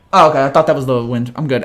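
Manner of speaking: normal talking voice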